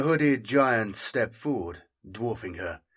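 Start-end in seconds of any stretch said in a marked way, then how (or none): none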